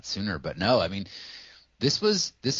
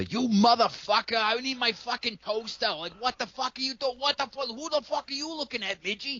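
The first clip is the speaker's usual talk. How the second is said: much higher, yelling Massachusetts townie voice; hammed-up angry townie fighting over a toaster; mock screaming townie. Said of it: "screaming at each other".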